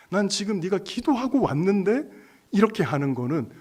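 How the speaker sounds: fervently acted divine address; impassioned divine reassurance. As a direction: raise the voice as God pressing the point. Acts God's earnest reassurance, rising.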